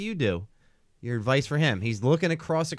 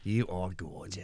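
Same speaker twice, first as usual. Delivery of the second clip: put-on Australian accent; 7 semitones lower, 9 dB quieter, slower than usual. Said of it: "Australian accent, please".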